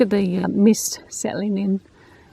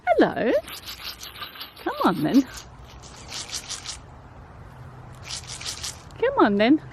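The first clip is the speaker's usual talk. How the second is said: coaxing a wild bird; 4 semitones higher than usual. Real, not acted. Coaxing birds to food.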